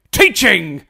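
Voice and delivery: loud gruff-style shout of the word; mock Moody bark. Host acting Moody answering the students, loud bark. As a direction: bark it like the unhinged teacher.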